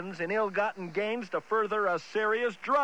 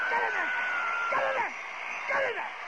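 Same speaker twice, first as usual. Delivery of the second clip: rising commanding yell; 13 semitones higher, faster than usual. Acted. Ordering, higher and higher.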